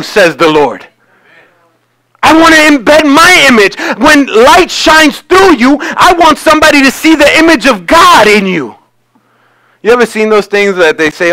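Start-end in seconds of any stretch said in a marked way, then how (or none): 2.2-8.8 prophetic declaration. Acted. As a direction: proclaim it as the Lord, stretching key words. Declares as the Lord.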